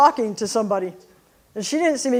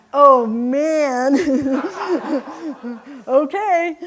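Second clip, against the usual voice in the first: loud, laughing, higher voice; laughing disbelief; 4 semitones higher, 6 dB louder, much slower than usual. Retold or imitated. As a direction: burst out laughing in shock. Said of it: "And I went".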